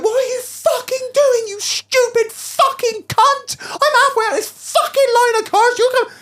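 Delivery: very high, drawn-out cursing tirade; hammed-up outraged yelling, stretch the swear words; retold furious rant. Driver cursing out the window, high exasperated rant.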